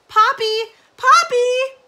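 high, very loud calling; rising shout. A narrator's reading. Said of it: "Her voice rose to a shout".

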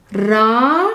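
راحت said "raa-hat", a rising, questioning lilt; repeated model word. Shown as a demonstration. Teacher repeating راحت for learners to copy. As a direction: repeat راحت with a lift at the end. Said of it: "So راح becomes".

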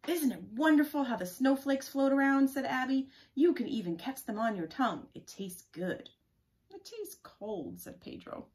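animated, loud picture-book reading; story read-aloud. Acted. Reading children's talk about snowflakes from a book, animated. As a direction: lift the children's lines as you read.

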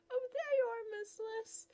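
high delighted squeal; delighted cry. Acted. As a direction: burst out with joy at seeing her. Suitor spots her, delighted.

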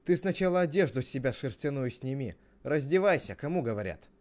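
loud, insistent demand; bossy acted scolding; angry shout back. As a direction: yell back in a quarrel. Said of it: "А в ответ".

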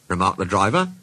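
ordinary delivery

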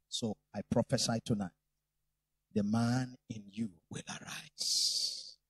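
low, solemn declaration; preaching prophecy. Acted. Preacher prophesying over the men of the congregation, low and solemn.

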